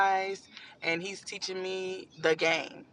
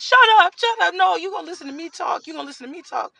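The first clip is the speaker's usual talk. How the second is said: high, loud shout of shut up; hammed-up furious yell; 10 semitones higher, 11 dB louder, much faster than usual. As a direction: scream her down to cut her off. Cousin yelling over her.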